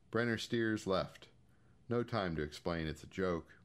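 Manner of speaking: ordinary delivery, nothing marked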